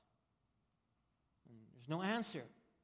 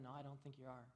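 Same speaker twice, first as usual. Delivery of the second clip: much softer and lower, quietly unconvinced; quiet doubting stranger; 5 semitones lower, 10 dB quieter, faster than usual. Retold or imitated. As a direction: shake your head and murmur it. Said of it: "the outsider looks at them and shakes his head".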